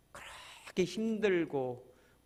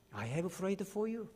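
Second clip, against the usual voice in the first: slow and softer; soft tender quote; 4 semitones higher, 8 dB quieter, much slower than usual. Acted. Jesus assuring the listener of his prayer, tender and soft. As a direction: say it like a gentle promise.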